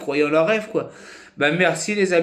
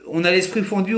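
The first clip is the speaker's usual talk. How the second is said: ordinary talk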